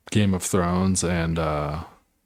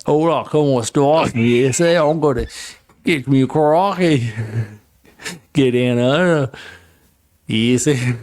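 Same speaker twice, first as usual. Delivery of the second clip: Spanish words in a hammed-up Australian accent, higher; Spanish through a mock Aussie accent, pitched up; 4 semitones higher than usual. Asked by his cohost, Spanish in a mock Australian accent. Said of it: "Speak Spanish in an Australian accent".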